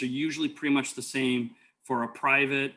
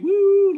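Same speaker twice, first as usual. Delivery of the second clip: loud, high "woo" like a siren wail; whooping siren noise with lights on; siren imitation; 12 dB louder than usual. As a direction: mimic the siren going off. Imitating the siren while driving.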